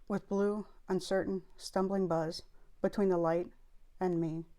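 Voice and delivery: soft, measured poem recital; poetry reading. Acted. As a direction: read the poem quietly, pausing at each break. Reads the poem aloud.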